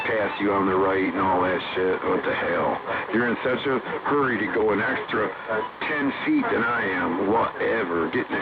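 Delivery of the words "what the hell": ordinary talk, nothing marked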